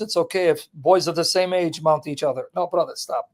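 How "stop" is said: usual speaking voice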